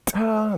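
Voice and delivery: "ta" with a big, punched T, held high; accented T. Shown as a demonstration. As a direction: punch the T at the start of "ta". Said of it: "That big T is all I".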